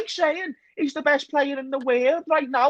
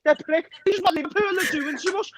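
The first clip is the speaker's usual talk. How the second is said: ordinary delivery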